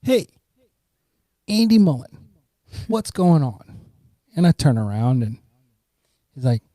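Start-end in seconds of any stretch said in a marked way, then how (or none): 0.0-3.8 put-on excited shout of recognition from an old classmate; calling out. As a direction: call his name out across the street. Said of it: "this guy goes".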